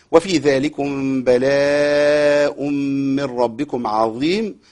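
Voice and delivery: slow, measured chanting of the verse; Quran recitation. Shown as a demonstration. Teacher recites the verse for students, slow chant. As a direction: recite it calmly with long vowels.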